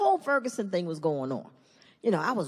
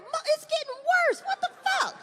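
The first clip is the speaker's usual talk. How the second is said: high squealing exasperation; exasperated. Acted. Father hearing nickname shrink, high exasperated squeal. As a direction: whine it way up high in disbelief. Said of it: "I'm like".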